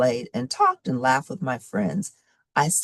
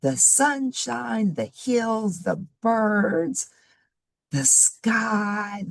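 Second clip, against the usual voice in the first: put-on reading to children; 5 semitones higher, slower than usual. Teacher recaps story for children.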